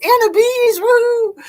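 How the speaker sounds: high loud squeal of a greeting; delighted whoop for a regular viewer; excited cheer. Real, not acted. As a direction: cheer her name like spotting a friend.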